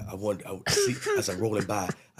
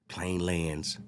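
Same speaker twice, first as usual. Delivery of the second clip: usual speaking voice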